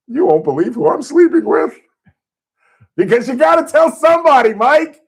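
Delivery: high, loud punchline yell about who he's sleeping with; bragging shout. Retold or imitated. Telling buddy George the secret, high loud bragging shout. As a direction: blurt it out, far too loud.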